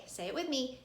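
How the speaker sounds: usual speaking voice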